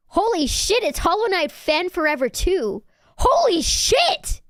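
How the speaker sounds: high, loud fangirl burst; over-excited. Real, not acted. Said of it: "Sorry, I got so excited".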